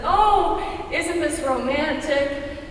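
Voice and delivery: normal talking voice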